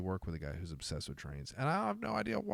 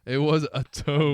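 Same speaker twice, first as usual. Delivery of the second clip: mock grandeur; 7 semitones higher, 12 dB louder than usual. Mock-grand echo of friend.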